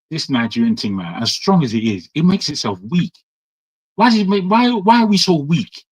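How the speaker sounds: ordinary talk, nothing marked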